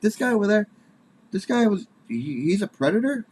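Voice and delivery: high, incredulous double-take; shocked disbelief. Retold or imitated. Comedian hearing a neighbor is a known predator, shocked and high. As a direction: gasp it out in stunned disbelief, pitched high.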